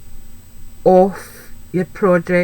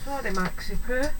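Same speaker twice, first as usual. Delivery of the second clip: high, soft and cooing; tender apology to pet; 5 semitones higher, 7 dB quieter than usual. Real, not acted. Sorry to her pet Maxi Poo, soft and high. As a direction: coo a little sorry at the dog.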